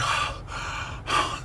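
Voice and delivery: long tired breathy sigh; weary sigh. Retold or imitated. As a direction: sigh out, worn out from the stairs. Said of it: "I'd be like".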